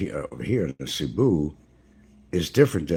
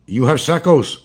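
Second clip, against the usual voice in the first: much higher and louder broken English in a put-on Filipino accent; 10 semitones higher, 11 dB louder than usual. Asking the shopkeeper for sacks in a high, loud put-on Filipino accent. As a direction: ask for sacks like a Filipino local. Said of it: "gotta put the Filipino accent on".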